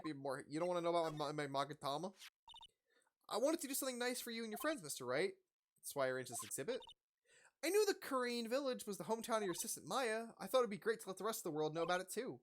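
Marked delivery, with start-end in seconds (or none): 3.3-12.4 higher, sweet-toned woman's voice; voiced Adrian. Adrian explaining the exhibit to Wright, higher woman's voice. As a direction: pitch it up, polite and grateful.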